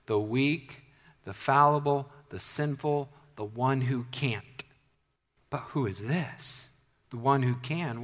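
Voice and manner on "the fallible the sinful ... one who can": usual speaking voice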